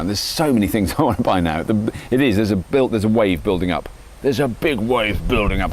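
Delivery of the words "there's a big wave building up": slow, lightly put-on doom voice; mock-ominous. Man warning of his urge to buy, mock-ominous. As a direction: stretch it out like a half-joking warning.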